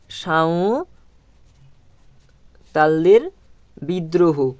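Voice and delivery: সাঁওতালদের drawn out very slowly; slow dictation. Shown as a demonstration. Teacher dictating the answer word to the class, very slow. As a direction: hold the word long so pupils can copy.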